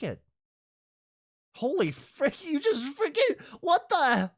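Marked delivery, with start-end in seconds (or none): 1.5-4.3 high, loud, amazed outburst; amazed shout. Real, not acted. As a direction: shout it out in disbelief at the catch. Amazed at a catch.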